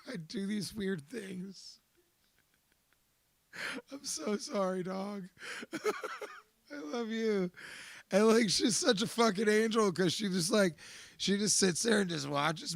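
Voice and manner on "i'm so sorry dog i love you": sincere apology. Real, not acted. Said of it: "apology now".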